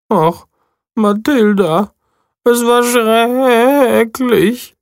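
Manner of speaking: high, lamenting sheep voice; high voice of a sheep telling a sad tale; high voice of a shorn sheep mourning its wool; sheep Stanley. Acted. Sheep character voice.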